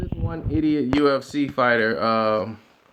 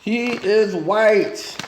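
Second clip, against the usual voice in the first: slow emphatic repeat; 5 semitones higher, much slower than usual. Acted. Repeats it very slowly.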